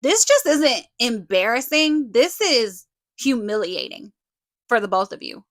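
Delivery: telling them off, full force; angry callout. Real, not acted. Furious callout at Kim and Lisa.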